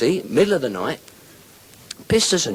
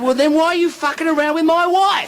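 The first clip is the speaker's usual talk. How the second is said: accusing punchline; 3 dB louder than usual. Acted. Drunk confronts wife's lover.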